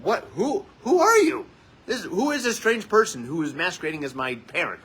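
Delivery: high, loud, stammering disbelief; mock astonishment. Daughter stunned by parent's apology, exaggerated astonishment. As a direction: squawk it like a baffled daughter.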